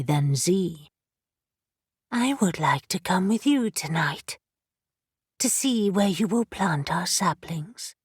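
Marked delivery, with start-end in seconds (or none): 2.1-7.9 soft, breathy and hushed. Acted. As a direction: breathe the words gently, almost whispering. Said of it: "her soft, breathy voice".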